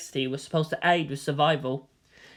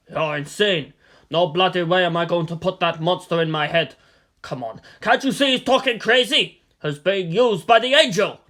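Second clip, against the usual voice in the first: angry general; 4 dB louder than usual. Acted. General refusing the mind worm, angry and loud. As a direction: shout down the mad scientist.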